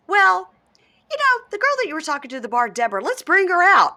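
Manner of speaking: high, showy mock-host announcement; imitated host. Host bringing out the girl from the bar, hammed-up announcer. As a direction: tease the reveal in a high, put-on voice. Imitating host's surprise guest reveal.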